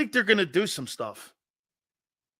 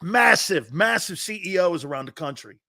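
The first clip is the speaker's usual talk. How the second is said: ordinary delivery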